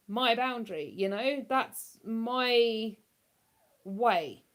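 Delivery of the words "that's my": usual speaking voice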